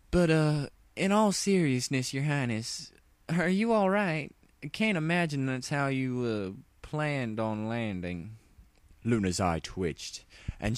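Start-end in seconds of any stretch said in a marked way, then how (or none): 0.1-8.4 high, hesitant concerned voice; polite worried country pony. Acted. Applejack asking the fallen princess if she's hurt, high worried voice. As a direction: check on her gently, unsure.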